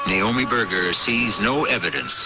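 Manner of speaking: ordinary delivery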